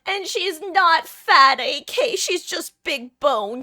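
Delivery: loud, high, drawn-out mock protest; joking indignant defense. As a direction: defend the cat with hammed-up outrage. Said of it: "I joke with the way".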